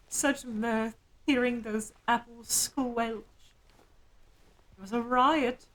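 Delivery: high, put-on voice of an old creature relishing fun; playful high ghoulish voice recalling apple-smashing joy; ancient gleeful being. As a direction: cackle through it like a centuries-old imp. Voicing a gleeful ancient figure.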